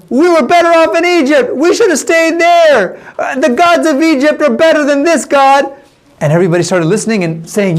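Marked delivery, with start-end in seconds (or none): 0.1-5.7 high, insistent rabble-rouser's yell. Retold or imitated. Jambres stirring up the Israelites, acted yell. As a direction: call it out high to stir up a crowd. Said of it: "he was yelling".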